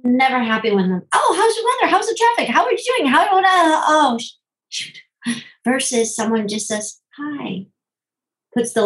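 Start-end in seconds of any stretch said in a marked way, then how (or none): 1.1-5.0 much higher and louder, a babbling flustered chatterbox; chatty therapist. Retold or imitated. Massage therapist chattering at a client, higher and flustered. 7.2-7.7 soft greeting. Retold or imitated. Calm massage therapist greets client.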